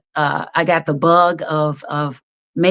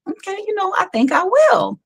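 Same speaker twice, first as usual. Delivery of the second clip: much higher, light and eager; high eager self-quote; 14 semitones higher than usual. Retold or imitated. Guest retells agreeing to a friend, high and eager. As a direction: chirp it like a hopeful girl.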